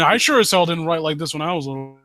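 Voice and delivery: ordinary talk, nothing marked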